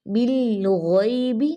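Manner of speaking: बिल गैबी slow, लाम given full time, गैन kept up; careful tajweed; slow recitation. Shown as a demonstration. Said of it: "ऊपर थोड़ा".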